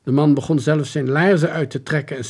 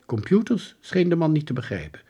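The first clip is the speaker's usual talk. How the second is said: plain reading voice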